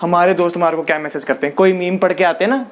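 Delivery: ordinary talk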